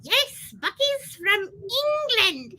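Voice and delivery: high-pitched mascot with a mock-British accent; squeaky put-on British voice; Bucky, British accent. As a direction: play a tiny character claiming English roots, British accent. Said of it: "now I have my British accent back".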